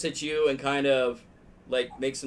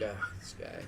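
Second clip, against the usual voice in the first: much softer dismissive aside; scornful mutter; 6 semitones lower, 10 dB quieter than usual. Acted. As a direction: brush him off under your breath. Aroka nods scornfully.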